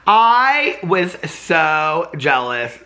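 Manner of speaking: very slow, high, drawn-out whine; mock jealous whine. Missing BravoCon, a slow high fake whine. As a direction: stretch it out like a sulky wail. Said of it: "that whole first part was all a joke".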